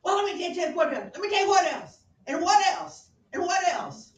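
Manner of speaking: high, very loud furious rant; mock furious shouting Hamlet. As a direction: pile on each charge, shouting. Hamlet ranting at mother.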